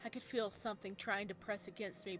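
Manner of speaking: ordinary delivery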